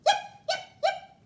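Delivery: very high, loud yips; Harry McClary's high yapping bark; yapping little dog. Acted. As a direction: yap like a tiny excited dog. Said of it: "said Harry McClary".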